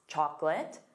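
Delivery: ordinary talk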